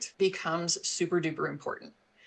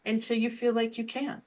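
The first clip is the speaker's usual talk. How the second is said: soothing parent; 5 semitones higher than usual. Shown as a demonstration. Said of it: "give the child the power of the pause".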